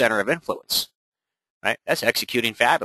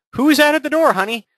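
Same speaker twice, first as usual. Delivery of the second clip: much higher, louder spouse calling from inside; mock spouse calling; 6 dB louder than usual. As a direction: call out from the other room in a high put-on voice. Spouse voice acted.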